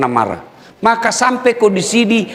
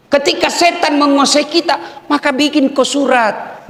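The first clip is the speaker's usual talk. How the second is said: ordinary talk, nothing marked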